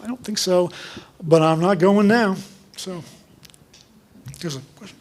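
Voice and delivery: ordinary talk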